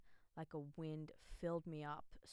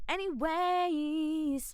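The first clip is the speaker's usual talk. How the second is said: drawn-out anyways; 11 semitones higher, 13 dB louder, much slower than usual. Acted. Drawn-out topic change to viewers.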